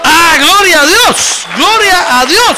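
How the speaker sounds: high, drawn-out, full-force praise shout. Real, not acted. Preacher rousing the congregation to praise, real exultant shout. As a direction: cry out to the whole church, slowly.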